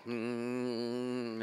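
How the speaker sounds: long, soft, low-key droning hum; imitated bee hum. Bees answering their queen, soft imitated hum. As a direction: hum steadily like a hive of bees. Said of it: "And they go".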